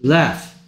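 "Left" as one clear, isolated word ending in an f sound; pronunciation model. Shown as a demonstration. Teacher correcting a student's word, clear model of "Left" with final f. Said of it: "다시 읽어보세요".

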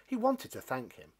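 ordinary delivery, nothing marked

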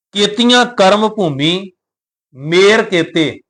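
slow verse with a clear break after Bhumi; pause after Bhumi. Shown as a demonstration. Teacher showing the pause to the sangat, slow and careful. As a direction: stop on Bhumi, then ਮੇਰ ਕੇਤੇ. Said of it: "ਪਹਿਲਾ ਵਿਸ਼ਰਾਮ ਆਪਾਂ ਦੇਵਾਂਗੇ ਭੂਮੀ ਦੇ ਉੱਪਰ".

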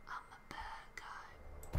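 breathy villain whisper; whispered bad guy. Shown as a demonstration. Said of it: "That's my bad guy voice".

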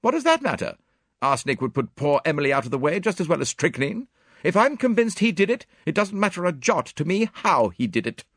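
high, loud, emphatic woman; impatient, high-pitched retort; indignant Miss Howard. A narrator's reading.

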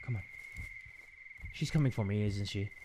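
scared near-whisper; fearful hush. Real, not acted. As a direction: shrink back in fear. Enemy approaching player.

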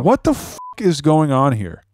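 bewildered outburst. Real, not acted. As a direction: pitch leaps up, then slows down. Son learns caller's story changed.

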